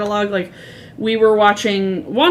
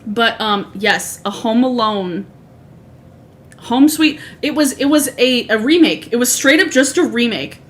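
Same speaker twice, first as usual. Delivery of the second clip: ordinary delivery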